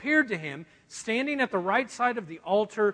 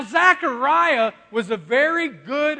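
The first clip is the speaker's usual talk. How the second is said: normal talking voice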